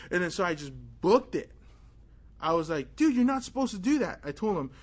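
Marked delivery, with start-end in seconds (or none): 3.0-4.2 higher, louder outraged complaint; indignant protest. Retold or imitated. Boy scolding the priest for opening the door, loud and high. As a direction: call him out, half yelling.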